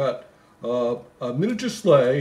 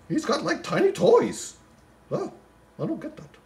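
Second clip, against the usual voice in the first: incredulous. Acted. Mock bafflement at tiny toys.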